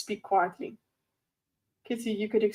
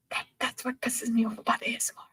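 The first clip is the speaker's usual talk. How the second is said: whispered gripe; whispering a pet peeve; 7 dB quieter than usual. Real, not acted. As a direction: hush it but keep the complaint. ASMR whisper.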